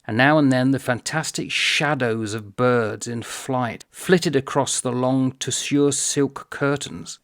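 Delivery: measured, lingering read-aloud; deliberate reading, slowing into the final phrase. Shown as a demonstration. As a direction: let each image land separately. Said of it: "but slowly".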